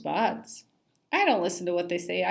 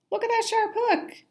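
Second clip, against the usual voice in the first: very high squeak; 3 dB louder than usual. Acted. Fish spotting hook.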